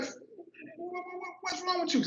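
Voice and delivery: imitating a high-pitched, stammering sister; excited stutter. Sister questioning her crying brother, excited stutter. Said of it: "She started too when she get excited".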